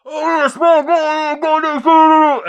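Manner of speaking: long, high, garbled holler; imitating a fan's drawn-out scream. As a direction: scream it out of a truck window, words slurred and stretched. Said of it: "screams out of the window".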